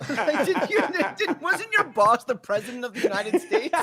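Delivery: laughing disbelief. Real, not acted.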